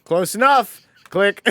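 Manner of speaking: put-on a kid settling for the wrong download, yelping high and loud; mock kid's shrug. Podcaster mimicking his kid self clicking the wrong song, high excited yelp. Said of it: "pops up and you're like".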